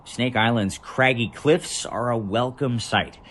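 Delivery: normal talking voice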